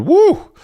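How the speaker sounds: high, loud whoop; excited whoop. Real, not acted. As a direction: let out a sudden whoop. Reacting to how dark the episode went.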